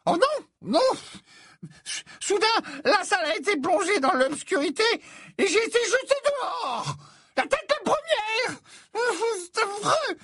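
a dithering prophetess confessing reluctantly; flustered seer. Acted. Trelawney recounts being thrown out.

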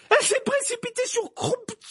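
very high-pitched, anguished scream. A narrator's reading. Professor tells of the attack on Croughton, screaming. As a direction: cry it out, nearly wailing. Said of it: "hurla-t-elle en tremblant".

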